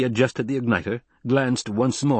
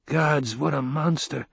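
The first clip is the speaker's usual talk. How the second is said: usual speaking voice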